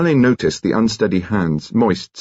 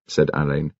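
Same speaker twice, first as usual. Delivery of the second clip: usual speaking voice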